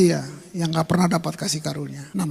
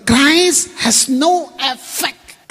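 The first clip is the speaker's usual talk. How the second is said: each word spaced out, higher and louder; slow emphatic English; 5 semitones higher, 5 dB louder, much slower than usual. Shown as a demonstration. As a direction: read it slowly like a verdict. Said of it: "Terjemahan bahasa Inggrisnya lagi nih".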